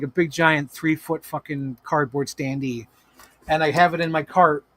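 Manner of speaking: normal talking voice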